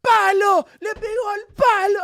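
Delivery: high, loud commentator yelling about the post; mock play-by-play shout; imitated commentator shout. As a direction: belt it out like a frantic Spanish commentator. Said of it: "He was like".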